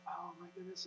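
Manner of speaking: very soft worried gasp of words; small, quiet exclamation; hushed alarm. Retold or imitated. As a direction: say it under your breath, retelling a parent's shock. Alarmed father reacting, retold.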